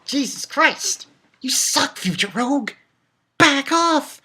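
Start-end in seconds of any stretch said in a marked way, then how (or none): none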